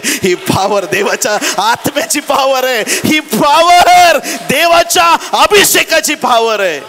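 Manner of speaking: building to a high, drawn-out shout; fervent preacher at full cry; full-force preaching. Real, not acted. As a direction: proclaim it fervently, stretching the climax.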